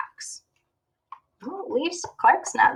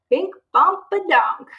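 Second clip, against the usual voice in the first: silly; 8 semitones higher, 4 dB louder than usual. Acted. Silly dance sounds.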